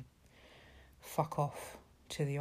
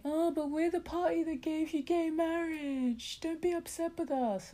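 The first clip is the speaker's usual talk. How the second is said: high, sugary mock voice, much higher pitch; mocking Tory excuse; 12 semitones higher than usual. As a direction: parrot the party line in a simpering high voice. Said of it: "the same excuse is gonna be".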